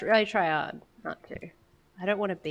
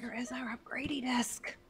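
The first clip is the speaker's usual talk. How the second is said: whispering; 4 semitones higher, 10 dB quieter than usual. Real, not acted. Whispered repeat of question.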